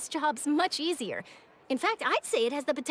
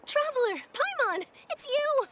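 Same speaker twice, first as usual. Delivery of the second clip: high, bright call of surprise; sudden glad surprise at seeing them; surprised greeting; 9 semitones higher than usual. Acted. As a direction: greet them, caught off guard.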